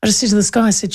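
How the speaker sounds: ordinary talk, nothing marked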